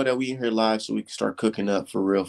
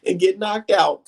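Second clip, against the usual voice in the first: raised-pitch mock disbelief; mock outrage; 7 semitones higher than usual. Host mimicking viewers upset over the Rigo fight, mock outraged. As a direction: imitate upset fans who can't believe it. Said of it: "everybody feeling a certain type of way".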